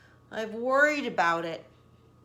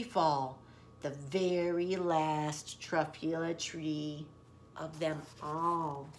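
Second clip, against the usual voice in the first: somber; 6 semitones lower, 7 dB quieter, slower than usual. A narrator's reading. Last tree falls, somber.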